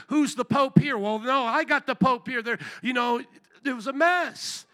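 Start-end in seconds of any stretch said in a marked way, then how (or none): 0.1-2.4 lightly acted bickering, higher and louder; mock squabbling. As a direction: act out rival claimants squabbling. Acted squabble of rival countries.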